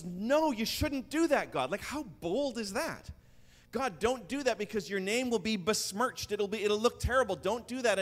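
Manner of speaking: high, urgent plea to God; pleading Moses. Retold or imitated. Moses pleading with God for his people, urgent. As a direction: beg God to stop, pitch up.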